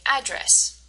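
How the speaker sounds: "address" with stress on AD; first-syllable stress. Shown as a demonstration. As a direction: say "address" as AD-dress. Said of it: "the first part".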